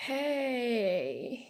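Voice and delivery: weak, drawn-out falling greeting; tired. Acted. Sick girl waking to classmates, tired. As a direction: let the word sag, worn out. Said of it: "Only you sounded tired".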